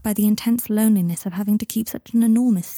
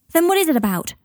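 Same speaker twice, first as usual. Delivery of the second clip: higher-pitched angry demand; furious; 9 semitones higher, faster than usual. A narrator's reading. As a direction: round on him, demanding an answer. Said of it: "rounding on him, furious".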